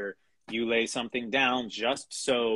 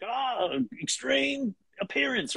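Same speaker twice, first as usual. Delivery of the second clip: high, slow, hammed-up Australian accent; an over-the-top Australian cartoon hero yelling his line; 13 semitones higher, slower than usual. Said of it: "scratch track in an Australian accent".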